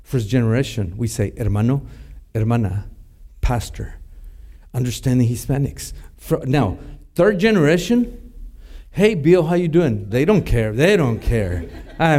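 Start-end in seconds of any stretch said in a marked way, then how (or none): none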